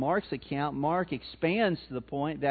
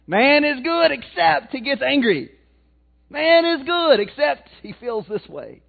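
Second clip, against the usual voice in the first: loud, raised-pitch mock scripture; sarcastic mock proclamation; 8 semitones higher, 8 dB louder than usual. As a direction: proclaim the absurd misquote loudly, tongue in cheek. Mock misquote of Genesis to congregation.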